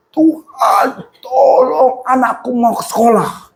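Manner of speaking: imitated worn-out, cracking voice; hoarse shouting. Father praying in car for child's schooling, imitated hoarse cry. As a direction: cry out as if your voice is gone. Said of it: "serak suaranya".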